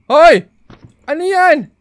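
high, loud startled call; alarmed shout. Real, not acted. Player seeing something coming, alarmed shout. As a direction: cry out in alarm.

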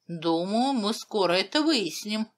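usual speaking voice

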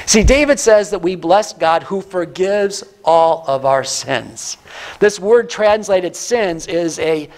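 ordinary talk, nothing marked

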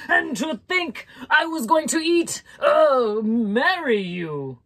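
high furious outburst that slows at the end; livid lizard. A narrator's reading. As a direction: let the slip catch, then drag marry you out. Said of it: "Don Lagarto was livid".

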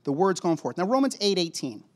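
normal talking voice

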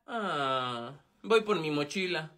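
low, deflated "ah"; disappointed. Acted. Pupil learning he must stay behind, disappointed. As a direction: let it sink low in disappointment. Bad news about resit classes.

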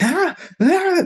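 very high frantic yelps; panicked cries. Retold or imitated. Frantic patient crying out, high panicked yelps. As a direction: yelp out in panic.

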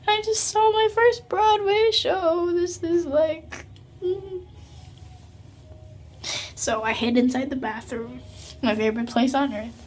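hushed and moved. Real, not acted. Fan in the bathroom speaking softly, overcome. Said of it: "a little emotional here".

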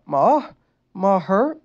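put-on high, loud childlike worry; worried tone. A narrator's reading. Injured boy asking his mother, worried. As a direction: ask like a frightened child. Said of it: "Lincoln asked in a worried tone".

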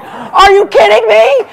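mock-outraged shout at the crowd. Real, not acted. Outraged at pregnancy Spanx.

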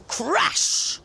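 shouted crash, high and loud; crash sound effect. A narrator's reading. Narrator booms the giant's crashing steps. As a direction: boom it out like a falling giant.

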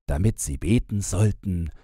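plain reading voice

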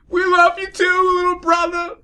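very high, loud, slow shout; shouted apology. Acted. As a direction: yell it out together. Fillies shout together.

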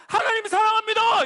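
high, loud, bold proclamation; confident declaration. Shown as a demonstration. Showing children how to confess love for God, confidently. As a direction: proclaim it proudly at full voice. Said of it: "이렇게 자신 있게 얘기하고".

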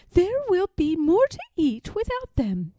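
a harried mother pig at the end of her tether; fretful mother pig. Acted. Aunt Pettytoes complaining about her piglets, high and loud.

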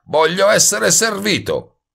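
high, loud, drawn-out demand; yelling after someone. A narrator's reading. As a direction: bellow the demand slowly at his back. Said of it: "gli urlò dietro Goebel". (urlo acuto, scandito e prepotente)

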